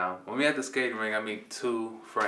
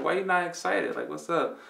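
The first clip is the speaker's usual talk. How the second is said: much higher, louder, puzzled uncle; 9 semitones higher, 3 dB louder than usual. Retold or imitated. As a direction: uncle surprised at the gloom.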